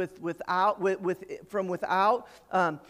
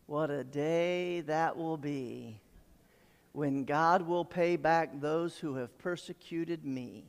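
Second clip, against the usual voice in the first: slow, drawn-out, low recital; slow, measured declaration; savoring slowly; 3 semitones lower, 4 dB quieter, slower than usual. Acted. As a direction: stretch out the words with weight, softer and lower. Relishing a verse, then repeats it.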